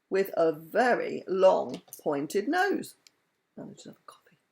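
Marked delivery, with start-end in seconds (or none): 3.6-4.4 whisper. Real, not acted. Aside whispered.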